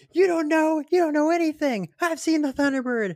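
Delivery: high squeaky mocking voice; mock angry listener. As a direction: squeak it out like a know-it-all fan. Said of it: "They'll be like".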